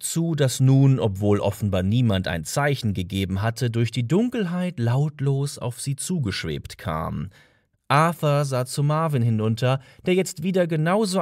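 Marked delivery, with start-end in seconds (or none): none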